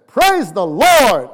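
Brother Max's praise. Retold or imitated. Pastor imitates a fervent church brother's praise, booming and slow. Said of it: "when Brother Max says".

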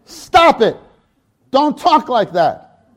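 high, loud and slow rebuke; father cutting off his son's apology; stern father. Acted. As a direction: cut him off firmly. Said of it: "Ricky Acosta amplified version".

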